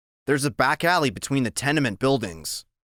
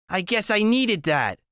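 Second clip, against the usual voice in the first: woman's voice; 9 semitones higher than usual. A narrator's reading. Sleepy Loretta voiced.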